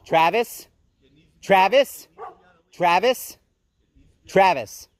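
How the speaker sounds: rising, questioning name call; calling for attention. Real, not acted. Woman trying to get Travis to listen, insistent rising call. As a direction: call the name repeatedly, lifting the end.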